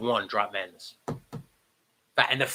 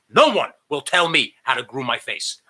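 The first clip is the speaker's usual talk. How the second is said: ordinary talk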